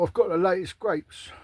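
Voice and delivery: hammed-up pompous Roman bragging; mock posh Roman. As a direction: brag about your grapes like a pompous Roman.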